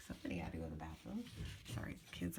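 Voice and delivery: fast whispered aside. Real, not acted. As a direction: hurry it under your breath. Kids interrupt, hushed aside.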